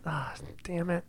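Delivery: unvoiced, muttered damn it under the breath; whispered curse. Real, not acted. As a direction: hiss it to yourself in dismay.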